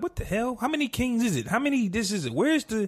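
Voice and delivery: high, confused what-the-hell; baffled outburst. Retold or imitated. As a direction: act lost mid-episode. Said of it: "I'm like".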